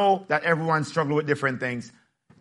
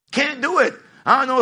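normal talking voice

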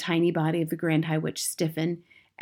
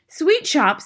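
crying out; 10 semitones higher, 6 dB louder than usual. Acted. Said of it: "they cried".

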